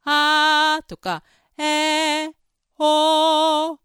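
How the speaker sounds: loud, slow, sustained open vowels; wide vowels. Shown as a demonstration. Voice teacher demonstrating vowels with a wide open mouth. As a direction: bite wide and hold each vowel. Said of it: "ガブッとこう".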